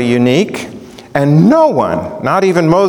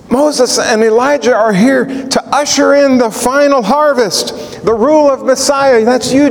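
Peter's thoughts, raised high with eager joy; ecstatic Peter; 8 semitones higher than usual. Retold or imitated. Peter realizing the final feast is here, ecstatic. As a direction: voice Peter seeing it all come together. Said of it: "He's ecstatic about the fulfillment".

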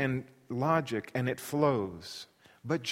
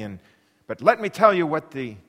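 ordinary talk

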